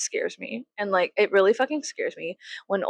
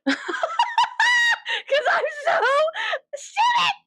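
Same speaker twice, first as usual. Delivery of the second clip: high laughing shriek through the words; laughing hard. Real, not acted. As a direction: crack up at your own stupidity. Laughing at herself.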